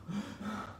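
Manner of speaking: wordless, winded heavy breathing; out-of-breath panting. Acted. Preacher imitates friends who climbed the roof carrying a man, winded panting. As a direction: huff and puff, acting worn out. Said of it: "Probably they was just going".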